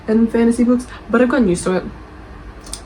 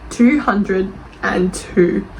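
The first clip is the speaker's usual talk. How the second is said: slow repetition; much slower than usual. Acted. Repeating the statistic slowly.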